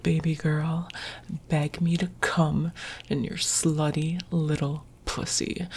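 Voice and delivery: ordinary talk, nothing marked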